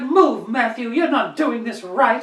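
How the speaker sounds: slow, high mock-French scolding; French accent. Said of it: "Only in a French accent".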